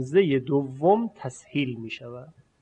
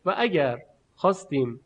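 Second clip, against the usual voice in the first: normal talking voice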